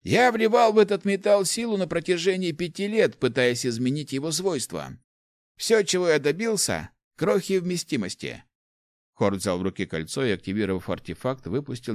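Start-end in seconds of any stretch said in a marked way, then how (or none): 0.0-8.4 put-on higher-pitched, animated old man's voice; old inventor, exasperated. Old inventor shows his meagre result to the rector, exasperated. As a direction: lament years of effort, pitched up and lively.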